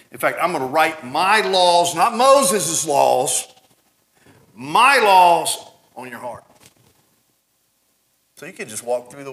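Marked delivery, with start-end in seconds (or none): 0.1-6.4 forceful preaching. Acted. Preacher quoting God with force.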